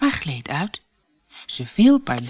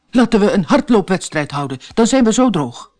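louder, odd bird voice of the dodo; dodo's voice; 3 semitones higher, 5 dB louder than usual. A narrator's reading. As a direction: proclaim the idea as the big dodo. Dodo proposes race. (luide dodostem die een wedstrijd voorstelt)

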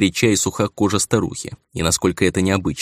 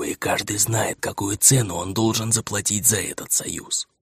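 put-on hoarse secretive old woman; old woman whispering; 3 semitones higher than usual. (приглушённый шёпот старухи)

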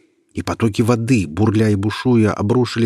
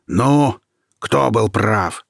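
triumphant; 2 semitones higher, 4 dB louder, much slower than usual. Acted. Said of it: "поглядел на него с торжеством".

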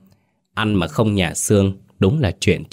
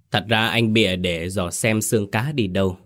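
usual speaking voice

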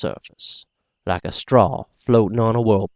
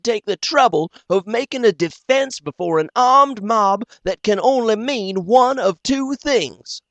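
doctor voice; 12 semitones higher, 3 dB louder than usual. Acted. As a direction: lift the voice into the doctor, unhurried. Doctor character voice, high.

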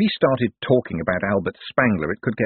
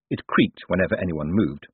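ordinary delivery, nothing marked

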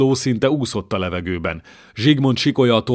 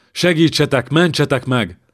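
panicked scream; 5 semitones higher, 3 dB louder than usual. Acted. King screams for rescue.

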